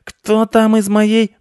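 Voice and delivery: very high, loud, slow cat wail; yelling. A narrator's reading. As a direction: howl the outraged question. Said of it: "завопил Рыжопиус". (возмущённый вопль)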